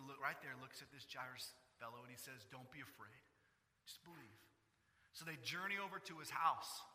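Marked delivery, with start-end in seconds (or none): 2.5-4.4 hushed, lower comfort; calm him gently; soft reassurance. Retold or imitated. Jesus softly reassuring Jairus not to fear.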